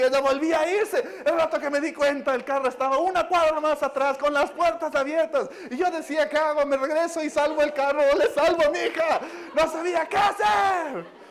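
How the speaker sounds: relato agudo y fuerte, cada vez más angustiado; desesperada. Retold or imitated. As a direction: imita el pánico hasta acabar gritando. Esposa cuenta su dilema, desesperada. (desesperación actuada, a voz en cuello)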